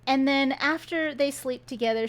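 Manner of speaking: normal talking voice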